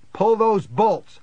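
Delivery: loud, high urgent yell; desperate shouted command; screamed order. A narrator's reading. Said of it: "I screamed at Diane".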